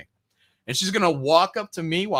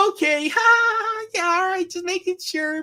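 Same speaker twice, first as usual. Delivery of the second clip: much higher and slower, a fake polite laugh in a squeaky voice; mocking high prissy voice. Man mocks the township lady backing away, in a squeaky high fake-polite voice. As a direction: chirp it out like a fake-sweet clerk backing off. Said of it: "she's like".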